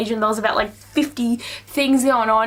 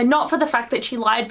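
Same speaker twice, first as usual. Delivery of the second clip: ordinary delivery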